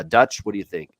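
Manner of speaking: ordinary talk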